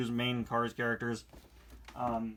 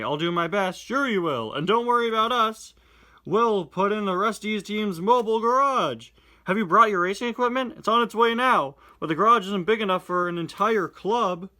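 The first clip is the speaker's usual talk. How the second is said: louder, higher character reading; animated reading of the twins and Sally; comic dialogue read-aloud; 8 semitones higher, 9 dB louder than usual. Acted. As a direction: perform the comic lines with energy. Comic dialogue voiced loudly.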